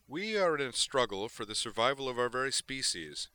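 indignant admiral pushing back; heated retort. A narrator's reading. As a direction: argue back forcefully. Admiral argues back in quarrel.

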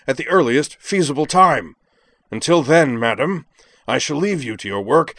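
scowling declamation. A narrator's reading. Jakron rebukes the dean over a callous eviction, scowling declamation. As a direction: build the tirade with punched words. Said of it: "then scowled, whirled, and continued".

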